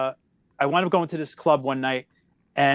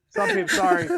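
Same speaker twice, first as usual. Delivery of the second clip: squeaky. Real, not acted. Squeaky apology amid laughter.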